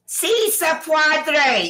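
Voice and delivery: slow, drawn-out mock Spanish slogan, stretched. Mocking Biden's campaign chant, a slow butchered Spanish slogan. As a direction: mangle the Spanish chant on purpose, very slowly. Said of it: "You can't even say that shit right".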